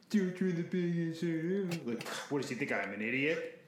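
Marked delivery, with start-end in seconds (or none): 0.1-1.9 high, mocking parrot of a warning; mocking mimicry. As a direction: mimic the warning in a silly higher voice. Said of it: "pacing in the basilica, like".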